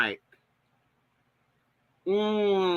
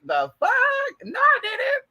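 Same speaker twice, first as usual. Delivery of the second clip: very high-pitched indignant squeal, retold; mad retort; slower than usual. Vlogger retells a mad comeback over a burrito, squeaky indignation. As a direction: shoot the voice way up, drawing out the disbelief. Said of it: "And I was mad".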